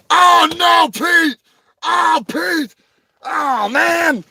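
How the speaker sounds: high, loud shouting alarm; hammed-up shock; mock panic. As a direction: cry out as if the toy broke. Mock panic as Pete breaks.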